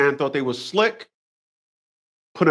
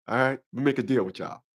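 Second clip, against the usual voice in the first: usual speaking voice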